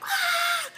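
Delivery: high, loud release scream; cathartic scream. Pretend. Mom imagining screaming it all out, high loud yell. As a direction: let out one big high yell of frustration. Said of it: "how nice would that be if you could just be like".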